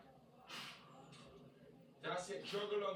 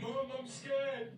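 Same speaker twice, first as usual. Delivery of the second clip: put-on scared child; 7 semitones higher, 3 dB louder, much slower than usual. Child frightened during fight.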